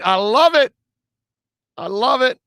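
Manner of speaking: high, loud, delighted approval; excited approval. Real, not acted. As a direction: cheer the caller on. Host cheering caller's joke.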